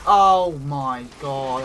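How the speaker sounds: loud, drawn-out exasperation; exasperated cry. Real, not acted. Player to self after missing, loud exasperated cry. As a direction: cry out slowly and loudly at a miss. Misses in a tense fight.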